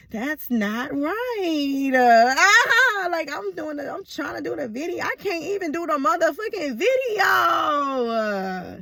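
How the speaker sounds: high, wailing mock tantrum; playful overblown exasperation; hammed-up frustration. Acts out frustration at making videos.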